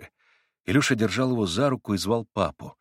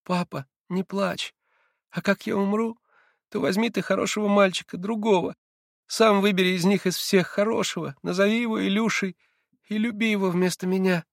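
put-on tender dying child; 11 semitones higher than usual. A narrator's reading. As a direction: comfort his weeping father. Dying boy consoles father. (тихо, нежно, печально)

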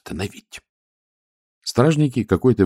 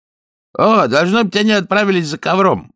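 crowd voice; 13 semitones higher, 5 dB louder than usual. Acted. Someone in the crowd guesses.

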